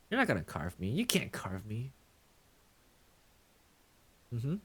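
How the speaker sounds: normal talking voice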